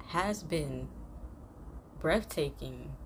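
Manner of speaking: ordinary talk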